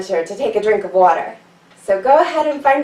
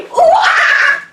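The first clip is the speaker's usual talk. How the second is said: very high loud monkey whoop; high loud laughing monkey call; monkey cry; 6 dB louder than usual. Shown as a demonstration. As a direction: leap and shriek like a startled monkey. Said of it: "scared monkey jump".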